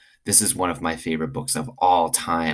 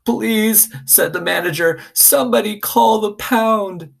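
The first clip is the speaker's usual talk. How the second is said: high, whiny pleading voice; crying manager; 4 dB louder than usual. Acted. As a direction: beg through tears like a beaten man. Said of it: "he started to cry".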